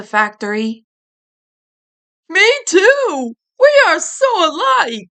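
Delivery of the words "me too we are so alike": high, loud goofy delight; exclaiming. Acted. Ed happily agreeing with Eddie, excited exclaim. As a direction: cheer it out dopily. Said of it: "Ed exclaimed".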